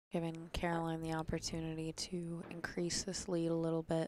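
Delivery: ordinary talk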